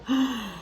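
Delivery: sharp offended intake of breath; shocked gasp. Acted. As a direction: gasp as if insulted. Reacting to the insult.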